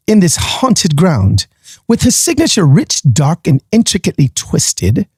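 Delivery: hammed-up amateur British accent; horrible British accent. Podcaster reading a suspense blurb to listeners, bad British accent. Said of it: "horrible British accent in the middle of that".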